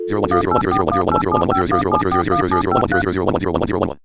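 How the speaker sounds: deep, fast, loud robotic binary stream; robot saying binary. Acted. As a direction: rattle off binary like a machine. Machine speaking in binary code.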